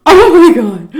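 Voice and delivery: loud laugh through the words; laughing loudly. Real, not acted. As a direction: burst out laughing as you say it. Laughing recalling the scene.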